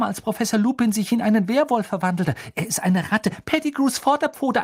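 high, loud, tumbling flood of words; blurting it out. A narrator's reading. Two children blurting proof of innocence to their headmaster, frantic. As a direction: gush out frantic explanations. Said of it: "Beide sprudelten gleichzeitig los".

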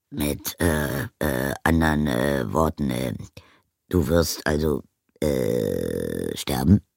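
a plain craftsman groping for the right word; low voice full of ums; hesitant blacksmith. A narrator's reading. Smith voice again.

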